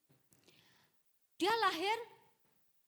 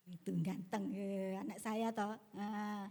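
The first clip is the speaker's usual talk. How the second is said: usual speaking voice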